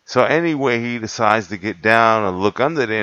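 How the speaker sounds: ordinary talk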